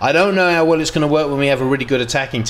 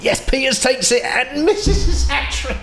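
high, laughing play-by-play; laughing commentary; 14 semitones higher than usual. Real, not acted. Gamer calling the penalty miss, laughing. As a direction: narrate the miss through laughter.

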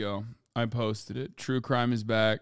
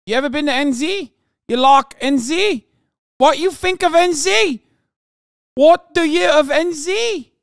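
put-on loud, much higher New Zealand accent building to a yell; a rowdy Kiwi bloke in a New Zealand accent, louder and louder; mock Kiwi accent; 12 semitones higher, 8 dB louder than usual. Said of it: "That's what he said".

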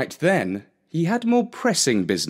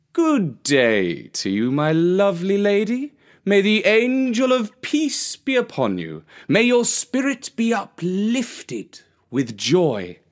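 sweet-talking sly fox, higher; cunning fox; 9 semitones higher than usual. Acted. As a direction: flatter the hen, oozing charm. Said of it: "far too cunning to show".

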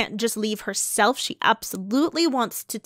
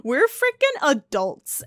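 mock outrage; 6 semitones higher, 8 dB louder than usual. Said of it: "She's like".

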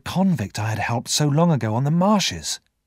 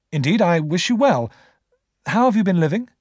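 higher, brisk goodwill; courteous young Pip; 6 semitones higher, 4 dB louder than usual. A narrator's reading. Pip asks after the convict's life, courteous. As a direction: wish him well, pitched up and earnest.

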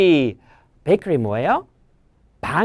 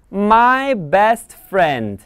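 slow title read; 2 semitones higher, much slower than usual. Shown as a demonstration. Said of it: "title read slowly".

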